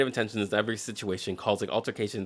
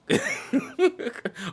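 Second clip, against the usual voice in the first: high laugh. Real, not acted. Laughs at own joke.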